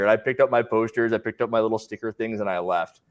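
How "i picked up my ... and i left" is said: usual speaking voice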